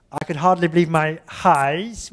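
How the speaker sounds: sounding out "eyes" clearly; careful eyes. Shown as a demonstration. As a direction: model the line for students. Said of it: "We say".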